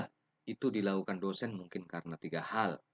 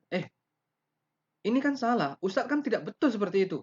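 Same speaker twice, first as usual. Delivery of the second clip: much louder and higher, fast contradiction; raised voice to teacher; 10 dB louder, faster than usual. Shown as a demonstration. Student loudly telling the teacher he's wrong. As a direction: argue back at the teacher. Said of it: "sudah meninggikan suara".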